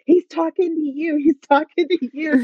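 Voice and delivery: high, urgent repeated whisper-shout feel turned up; excited nudge. Retold or imitated. Pastor urging her during the sermon, high excited insistence. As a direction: tell her eagerly it's meant for her.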